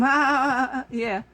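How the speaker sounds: lightly mimicked sycophant laughter; mock laughing along. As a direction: imitate a fawning laugh-along. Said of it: "Billy Bush laughing along with them".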